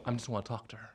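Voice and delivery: low, very soft little plea; meek retold plea. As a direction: murmur it harmlessly to a guard. Retells pleading with security.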